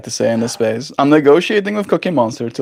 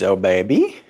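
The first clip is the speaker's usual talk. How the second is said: ordinary delivery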